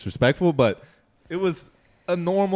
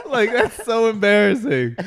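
laughing; 8 semitones higher, 4 dB louder, slower than usual. Real, not acted. Laughing while retelling.

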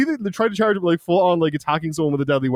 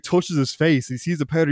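usual speaking voice

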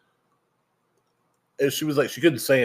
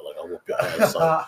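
higher, swaggering mock threat; mock tough threat; 7 semitones higher than usual. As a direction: play the tough guy, half joking.

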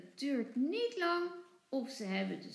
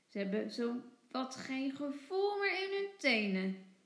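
ordinary delivery, nothing marked

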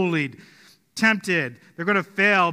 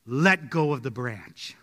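lower, slow and measured; slow, solemn command from above; voice of God; 4 semitones lower, slower than usual. Retold or imitated. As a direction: speak down calmly like a divine voice. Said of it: "And the voice says".

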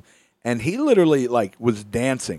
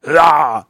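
mock battle yell; 8 dB louder than usual. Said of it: "The man was like".

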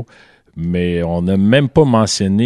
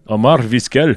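put-on heavier, higher Arabic-flavoured accent; arabic accent in french; 4 semitones higher, 4 dB louder, slower than usual. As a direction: push the Arabic accent harder on the name. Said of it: "Ça, c'est plus russe".

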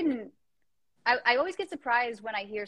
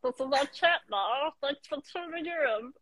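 chipmunk voice; 2 semitones higher than usual. Acted. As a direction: squeak it like a chipmunk, slowly. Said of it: "It sounds like a chipmunk".

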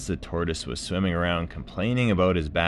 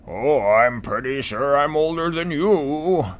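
put-on old tortoise voice; 10 semitones higher, 9 dB louder than usual. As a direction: groan it out like an aching old tortoise.

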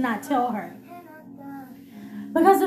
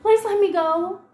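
higher, louder, choked retold sobbing; crying plea; 7 semitones higher, 3 dB louder, slower than usual. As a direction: beg through tears like a scared child. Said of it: "I'm bawling my eyes now, crying".

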